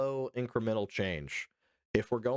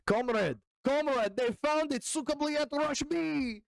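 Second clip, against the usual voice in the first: mock Russian soldier; slower than usual. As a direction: play a panicked Russian soldier raising the alarm in a silly put-on Russian accent.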